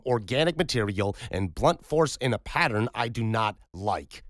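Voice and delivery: a surgeon listing hard facts to shut down argument; forceful doctor refusing to speculate; stern doctor. Acted. Roman's stern pronouncement.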